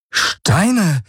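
a sudden high cry of discovery; excited exclamation. Acted. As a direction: blurt it out, high and amazed. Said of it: "rief er aus".

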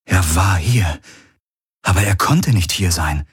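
ordinary delivery, nothing marked

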